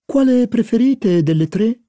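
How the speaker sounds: high, lightly voiced grand lady; haughty duchess. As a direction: ask it like a teasing old duchess. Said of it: "chiedeva al duca di Doudeville". (voce alta da gran dama ironica)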